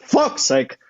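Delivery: lightly voiced exasperated TV chef; Gordon Ramsay. TV chef feeling sick backstage, mock exasperation. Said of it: "what he does in those shows".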